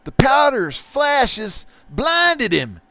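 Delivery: slow, hammed-up narrator voice; dramatic caption read. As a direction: announce it like a pulp comic narrator.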